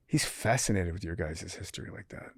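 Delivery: hushed aside, barely voiced; whisper. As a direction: whisper it so the inmates won't hear. Confiding to son about inmates.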